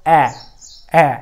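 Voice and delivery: ॲ, ॲ as isolated "a" of "cat"; short A. Shown as a demonstration. Teacher showing students short A, ॲ repeated separately. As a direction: say each ॲ alone, pausing between. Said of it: "एचा उच्चार हा ॲ केलेला आहे".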